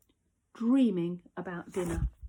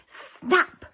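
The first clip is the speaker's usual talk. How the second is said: sharp, loud, higher burst; sudden snap; 6 semitones higher, 4 dB louder than usual. A narrator's reading. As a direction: break the hush with a snap. Sudden snap after whisper.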